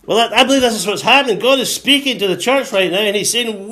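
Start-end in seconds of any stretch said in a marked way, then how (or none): none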